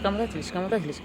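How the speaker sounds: ordinary talk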